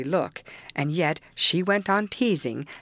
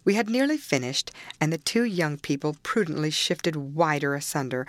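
usual speaking voice

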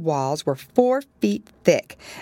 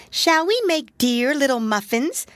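kitten voice; 9 semitones higher than usual. A narrator's reading. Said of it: "said Mittens to Moppet".

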